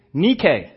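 Nike said NEE-kay; Greek pronunciation. Shown as a demonstration. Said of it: "is pronounced in the Greek".

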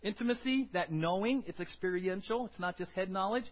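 loud, driving sermon voice; preacher urging church to grasp God's love, loud; preaching at full force. Acted.

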